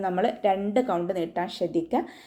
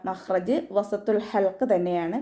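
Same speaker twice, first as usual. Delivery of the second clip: ordinary delivery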